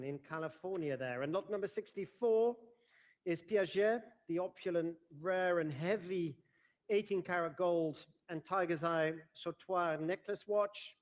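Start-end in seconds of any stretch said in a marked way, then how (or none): 1.2-10.9 bright formal lot announcement; auctioneer lot announcement. Real, not acted.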